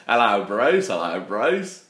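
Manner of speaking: loud Monty Python Australian greeting, rising at the end; hammed-up mock Australian; bad Australian accent. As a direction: call it out in a badly faked Australian accent. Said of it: "my bad Monty Python Australian accent".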